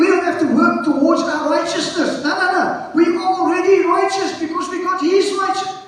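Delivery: high, urgent preacher voice; full-force preaching. Real, not acted. Preacher telling congregation they rest in salvation, full-force preaching. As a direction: drive each phrase out to the congregation.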